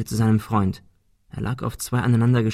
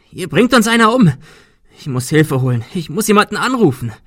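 panicked cry; 7 semitones higher, 6 dB louder than usual. Acted. Adrian panics, wants help.

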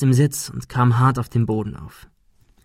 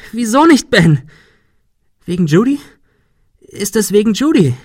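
high, loud questioning; shrill demand; 10 semitones higher, 7 dB louder than usual. A narrator's reading. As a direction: press him hard. Sarah presses Ben. (hohes, lautes Bohren)

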